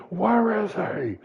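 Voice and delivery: slow, loud, high roaring demand; furious demand. Retold or imitated. Jalen demanding Antonio from the beaten heroes, furious. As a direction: roar it, dragging each word.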